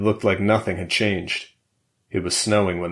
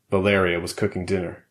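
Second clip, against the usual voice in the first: usual speaking voice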